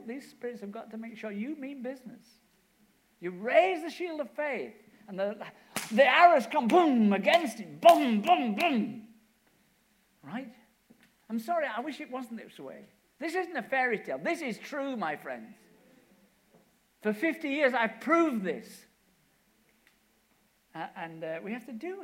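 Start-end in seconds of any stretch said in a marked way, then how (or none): 3.2-9.0 loud, high, slow booming sermon; preaching at full force. Real, not acted. Preaching climax, arrows hitting shield. 17.0-18.9 loud, slow vow; emphatic testimony. Real, not acted.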